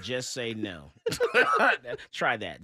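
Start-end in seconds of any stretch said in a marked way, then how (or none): 0.0-0.9 put-on low, sarcastic echo of an old campaign line; a lightly voiced mock slogan; Nancy Reagan slogan. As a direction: say it deadpan, mocking its uselessness. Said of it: "Nancy Reagan said".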